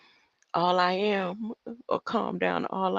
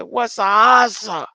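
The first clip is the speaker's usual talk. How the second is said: [name]; 3 semitones higher, 11 dB louder, slower than usual. Retold or imitated. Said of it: "He, he said".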